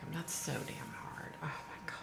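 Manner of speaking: soft breathy words; whispered sympathy. Real, not acted. Whispering sympathy to a grieving friend. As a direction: hush it in sorrow.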